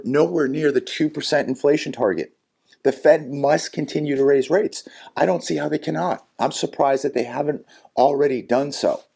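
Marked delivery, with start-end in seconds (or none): none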